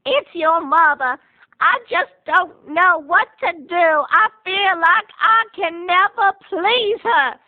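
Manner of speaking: put-on high, slow, pitiful old-lady voice; grandma complaining to her grandson, high and pitiful; whiny grandma. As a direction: plead for sympathy like a sad grandmother. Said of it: "And she says".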